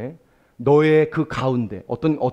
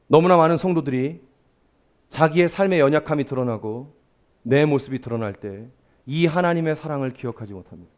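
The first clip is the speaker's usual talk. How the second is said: normal talking voice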